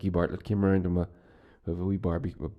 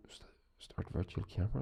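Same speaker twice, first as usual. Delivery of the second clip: whispered reading of a screen prompt; muttered whisper; 13 dB quieter than usual. Real, not acted. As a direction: whisper it to yourself, distracted. Reading a software prompt to himself.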